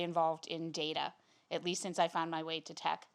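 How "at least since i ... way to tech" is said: ordinary talk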